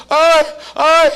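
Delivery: high, loud mock cries of pain; hammed-up birthing groans; labour cries. Preacher acting out Rebekah giving birth, mock pain cries.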